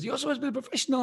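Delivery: imitating the teasing host. Host teasing him in front of everyone, high and fast. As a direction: rattle off a jibe at a colleague. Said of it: "He says".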